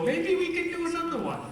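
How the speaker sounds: high-pitched imagined musing; imagined quote. Retold or imitated. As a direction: put it high and quick, a playful suggestion. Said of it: "caused you to go".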